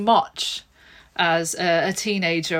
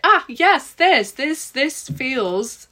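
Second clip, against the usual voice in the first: re-enacted joyful discovery; delighted relief; 6 semitones higher, much slower than usual. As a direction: exclaim it as if it finally feels right. Retells delight at finding her way.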